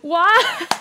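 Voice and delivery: high, loud wail of disbelief; upset outcry. Real, not acted.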